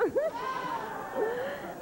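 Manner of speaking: shrill mock cackle rising up; high squealing laugh. Comic mocking a rival with a high squealing laugh. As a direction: squeal with catty glee.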